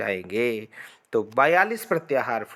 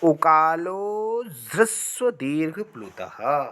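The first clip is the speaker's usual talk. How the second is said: slow chanted Sanskrit sutra; sutra recitation; slower than usual. Shown as a demonstration. Teacher reciting the sutra to students, slow chant. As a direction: recite the rule slowly like scripture. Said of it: "क्या कहा जा रहा है".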